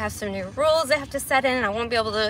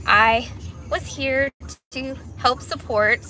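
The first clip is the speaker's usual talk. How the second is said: ordinary talk, nothing marked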